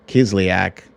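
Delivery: ordinary delivery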